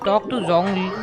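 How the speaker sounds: usual speaking voice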